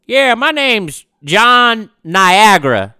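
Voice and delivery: high, loud, slow put-on caller voice; a hammed-up caller stating his name. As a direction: play the caller big and drawn out. Caller voiced in phone story.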